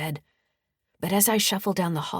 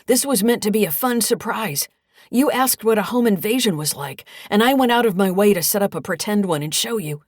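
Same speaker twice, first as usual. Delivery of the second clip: loud, higher-pitched indignant excuse; indignant protest; 5 semitones higher, 8 dB louder than usual. Acted. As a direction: protest loudly to talk your way out. Caught son protests to police.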